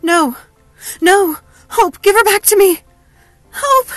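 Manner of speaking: high, loud, anguished screaming; screamed. A narrator's reading. Helena dragged from her sister, screaming. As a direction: scream desperately for her return. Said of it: "Helena screamed".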